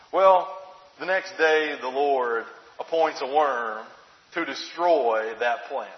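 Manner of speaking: ordinary delivery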